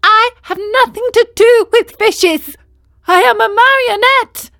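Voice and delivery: put-on high, loud boy puppet voice; high, loud Pinocchio voice; indignant puppet. A narrator's reading. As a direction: insist you are no fish.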